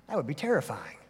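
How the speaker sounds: ordinary delivery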